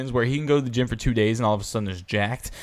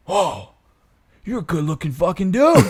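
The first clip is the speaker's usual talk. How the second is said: imitating aggressive admiration, louder; intense compliment; 4 semitones higher, 5 dB louder than usual. Stranger sizing up a ripped guy, intense praise. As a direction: compliment delivered like a confrontation, pitched up. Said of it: "he was so intense".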